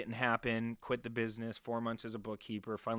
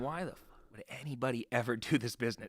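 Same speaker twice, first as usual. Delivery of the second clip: building from usual voice to loud, high protest; retold exasperation; 4 semitones higher, 7 dB louder than usual. Door knocker to himself after being turned away, retold exasperated outburst. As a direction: re-enact fed-up disbelief, getting louder. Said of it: "and I'm thinking".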